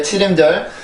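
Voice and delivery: ordinary delivery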